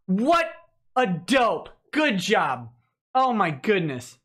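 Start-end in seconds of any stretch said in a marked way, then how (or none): none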